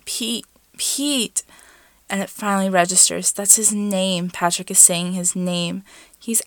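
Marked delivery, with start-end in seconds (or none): 0.0-1.4 rising breathless calls; gasping. Acted. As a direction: gasp his name, climbing higher. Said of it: "Patrick is gasping into his mouth".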